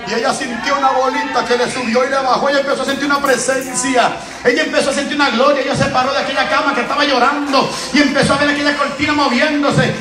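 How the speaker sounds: loud, high preaching building up; rising preaching. Acted. Preacher telling how the presence came, growing fervent. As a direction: build the story into fervour.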